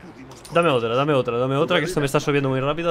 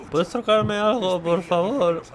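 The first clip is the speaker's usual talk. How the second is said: put-on much higher, slow drunken slur; hammed-up drunk voice, pitched way up; 10 semitones higher, slower than usual. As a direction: play a tipsy drunk asking the bartender. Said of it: "Esta es mi voz de borracho".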